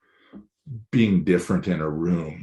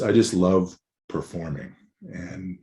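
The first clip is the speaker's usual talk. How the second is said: ordinary talk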